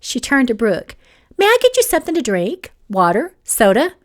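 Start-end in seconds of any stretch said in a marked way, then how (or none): 1.4-3.9 bright quick hostess chirp, pitched up; high, sing-song courtesy; overly polite offer. A narrator's reading. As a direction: offer refreshments with exaggerated politeness. Gracie's fake-sweet offer to Brooke.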